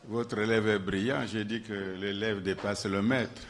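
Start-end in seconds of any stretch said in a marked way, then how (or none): 1.3-3.5 laughing through the line, then a long airy laugh; amused teasing laugh. Real, not acted. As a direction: chuckle at your own joke. Teases student surpassing master, laughs.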